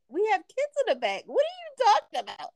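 high, exasperated retold objection; imitating her own startled protest; incredulous protest. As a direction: pitch it way up in disbelief. Retelling her protest to husband.

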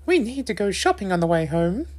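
high-pitched mother voice; chatty mum. A narrator's reading. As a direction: announce plans as Mum, pitched well up.

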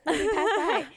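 high, loud retold disbelief; startled question. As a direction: blurt it out, acting baffled. Acts out startled reply to friend.

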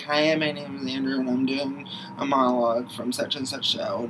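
soft, deflated audition voice; subdued audition introduction. Acted. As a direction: introduce yourself softly, losing all the energy. Acting out an underprepared auditioner's introduction.

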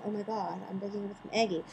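ordinary talk

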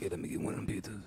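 quick, furtive whisper. Acted. Needy customer discreetly asking for pizza, whispered. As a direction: sneak the request out in a whisper. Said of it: "like, whisper".

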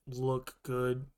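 usual speaking voice

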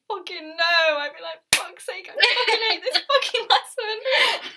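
high, loud comic outburst of dread; mock exasperation. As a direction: rant in hammed-up despair, half laughing. Acting out weekly dread of lesson.

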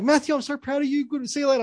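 lightly voiced proud mum saying goodbye.

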